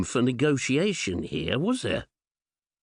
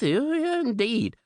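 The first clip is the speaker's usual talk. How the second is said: much higher, hammed-up cheer; mock enthusiasm; 10 semitones higher than usual. Ticking off Bournemouth with hammed-up delight. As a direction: oversell it with a wink. Ironic list of places visited.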